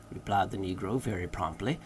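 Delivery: ordinary delivery, nothing marked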